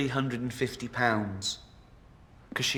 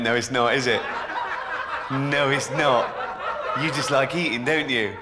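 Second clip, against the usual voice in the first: louder, laughing jeer; mocking; 3 dB louder than usual. Comedian mocks obese woman with a loud, laughing jeer. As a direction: laugh at her excuse.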